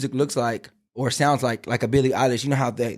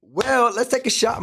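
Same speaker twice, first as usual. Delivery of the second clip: excited toast; 10 semitones higher than usual. Real, not acted. Toasting cheerfully.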